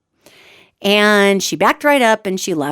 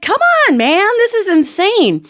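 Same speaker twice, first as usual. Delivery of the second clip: high, loud retold exasperation; exasperated protest; 11 semitones higher, 4 dB louder than usual. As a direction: plead in disbelief, high and stretched out. Acts out exasperation at driver.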